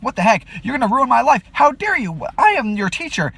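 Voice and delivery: high, loud, outraged yelling; angry outburst. Retold or imitated. Teacher yelling at the student who made a move, outraged and loud. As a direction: blow up at her in shock. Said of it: "I'm angry and I'm like".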